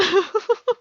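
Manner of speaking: odd high rising laugh in a put-on voice; weird laugh. Real, not acted. A vlogger lets slip a weird high laugh, odd put-on giggle. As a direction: let out that strange put-on laugh. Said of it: "why do I do that laugh".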